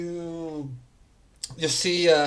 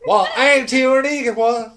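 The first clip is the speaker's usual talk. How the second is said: amused shopkeeper; 7 semitones higher, 8 dB louder than usual. Acted. GM voices shopkeeper woman.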